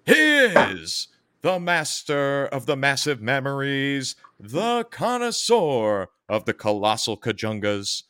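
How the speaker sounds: slow booming ring announcer intro. Acted. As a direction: announce him like a wrestling entrance.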